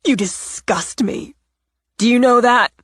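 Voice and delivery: disgusted jab. Acted. Natalie spits insult.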